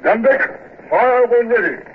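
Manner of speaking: high barked command; shouted order. Acted. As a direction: order the guns to fire. Giving battle orders.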